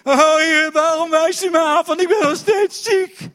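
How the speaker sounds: very loud, high hammed-up lament; mock wailing prayer. Imitating a sick believer complaining to God, mock wail. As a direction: cry out to heaven in exaggerated complaint.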